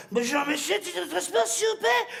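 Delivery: high polite official voice; ticket inspector voice. Retold or imitated. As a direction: play a prim inspector. SNCF inspector impression.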